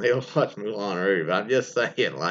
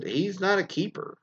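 normal talking voice

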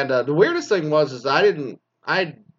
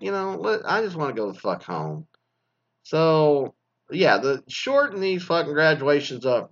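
normal talking voice

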